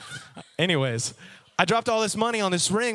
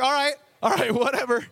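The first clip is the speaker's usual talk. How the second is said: shrugging it off; 8 semitones higher than usual. Retold or imitated. Mocking guy's shrug.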